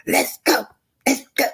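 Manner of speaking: high-pitched imitation of a bold demand; mock bold Tisha. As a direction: voice Tisha's sudden boldness. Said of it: "She got bold that day".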